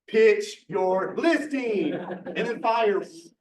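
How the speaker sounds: slow, drawn-out announcement with a laugh; hammed-up slow announcer read; dramatic read-out. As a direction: stretch each word out like a showy title. Reads out the video's prompt himself.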